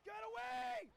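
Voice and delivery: high, loud frantic yell; panicked shout. Real, not acted. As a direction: scream at the monster to back off.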